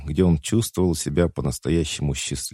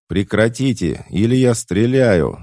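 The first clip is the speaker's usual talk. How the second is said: higher, louder threat; shouted warning; 6 semitones higher, 6 dB louder than usual. A narrator's reading. Nick warns the dancers with gun in hand, shouting. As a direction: raise your voice in a warning to stop. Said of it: "закричал Ник".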